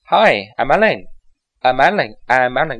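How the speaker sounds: "I'm Ellen" run together as one word; native linking. Shown as a demonstration. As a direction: link like a native speaker. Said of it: "老外就是". (像老外一样连读)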